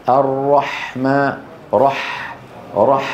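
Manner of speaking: الرحمن slowly, with a long breathed ح; correct breathy Ha. Shown as a demonstration. Teacher showing what must be said, full ح. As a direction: let the breath flow on ح in الرحمن and رح. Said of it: "يجب أن يقول".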